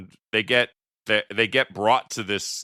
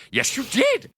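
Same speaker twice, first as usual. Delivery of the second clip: high squeaky lisping retort. Retold or imitated. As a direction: whine it high with a lisp. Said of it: "a lisp, and just his delivery of".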